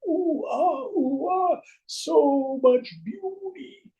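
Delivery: high, loud, drawn-out oohs and ahs; mock admiring oohs. Crowd at the procession, hammed-up admiration. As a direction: coo at the king's invisible suit. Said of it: "everybody's going".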